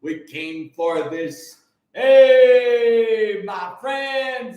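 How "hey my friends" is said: loud hype shout; calling out. Real, not acted. Calling out to his friends, a loud shout. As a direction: shout it out to the crowd.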